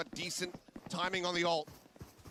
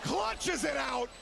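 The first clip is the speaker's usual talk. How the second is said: shouting; 9 semitones higher, 16 dB louder than usual. Real, not acted. Caster calling clutch kill.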